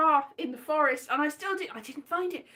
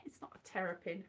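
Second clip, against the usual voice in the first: whispering; 6 semitones lower, 14 dB quieter, faster than usual. Real, not acted. Whispered aside.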